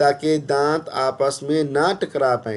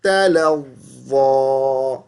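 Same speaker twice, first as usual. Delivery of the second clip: Quran recitation; 3 semitones higher, much slower than usual. Acted. Teacher recites the next verse melodically. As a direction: recite slowly with a drawn-out tune.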